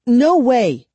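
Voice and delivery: high, loud denial; insistent. A narrator's reading. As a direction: insist hard. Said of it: "she insisted".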